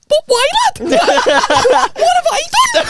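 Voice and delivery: squeaky high-pitched younger self, pleading; high squeaky kid voice. Retold or imitated. Teen retelling his younger squeaky self's panicked reply. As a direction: squeak it out like a little pipsqueak. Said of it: "I was going".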